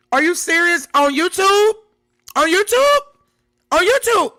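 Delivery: very high, loud squeal of surprise; shocked disbelief. Real, not acted. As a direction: shriek it in disbelief. Shocked discovery of video.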